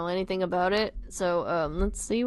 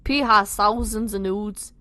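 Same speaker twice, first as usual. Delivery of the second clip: louder put-on Scouse; bad Liverpudlian accent; 5 dB louder than usual. Nick refusing his friends, bad Liverpudlian accent. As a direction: insist in a rough Liverpool accent. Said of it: "my shit Liverpudlian accent".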